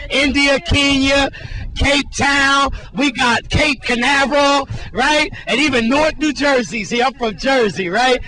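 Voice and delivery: loud, upbeat TV reporter wrap-up; reporter sign-off. Acted. Reporter hands back to Jerome, loud upbeat sign-off. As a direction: wrap the segment with big on-air energy.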